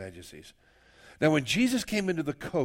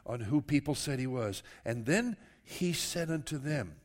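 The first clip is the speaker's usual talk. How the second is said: ordinary delivery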